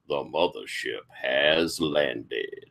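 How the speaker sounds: low, slow, soft announcer rumble; funk radio voice. Acted. The radio sprays out its last line, low slow cosmic announcer.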